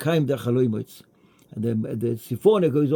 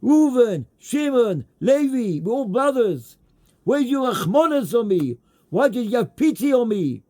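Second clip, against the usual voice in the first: crying, screaming plea; 8 semitones higher than usual. Retold or imitated. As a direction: beg the brothers from the pit, voice pushed high. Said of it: "he's crying and he's screaming".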